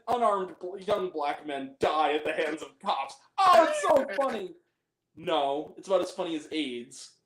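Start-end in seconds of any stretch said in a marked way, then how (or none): 3.4-4.5 drip with sarcasm; sarcastic. Acted. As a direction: fake amusement, clearly disgusted. Mocking a racist gag.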